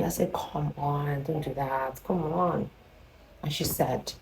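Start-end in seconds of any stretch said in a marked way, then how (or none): 0.3-2.7 shy murmur. Retold or imitated. Shy visitor protests to the neighbor, very softly. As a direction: retell it quietly, barely voiced.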